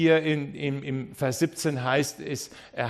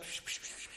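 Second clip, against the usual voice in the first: soft unvoiced shushing; gentle psch psch to cut off the apology; father's hushing; 18 dB quieter, faster than usual. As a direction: hush him like a parent calming a child. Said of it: "Der Vater sagt".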